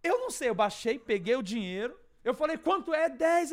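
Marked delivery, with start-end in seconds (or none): 0.0-0.5 high, loud panicked reply; retold bewildered yell. His shouted reply to shocked man. 2.6-3.5 loud, high rapid-fire dialogue; retold frantic exchange.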